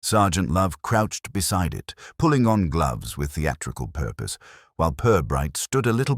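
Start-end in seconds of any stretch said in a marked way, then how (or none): none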